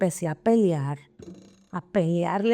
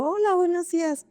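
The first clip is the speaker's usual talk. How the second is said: much higher, bright sing-song greeting; cheerful greeting; 9 semitones higher than usual. Retold or imitated. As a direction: greet him like you won the lottery. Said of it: "Y yo". (saludo alegre y mucho más agudo)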